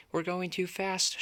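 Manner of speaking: lightly voiced alarm, read aloud; alarmed shout. A narrator's reading. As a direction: cry out that it's too fast. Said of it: "shouted Paisley".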